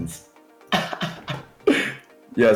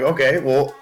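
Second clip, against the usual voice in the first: higher, louder exasperated outburst; exasperated rant; 9 semitones higher, 4 dB louder than usual. Retold or imitated. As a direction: vent at the backseat chatter, exasperated. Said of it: "And I'm like".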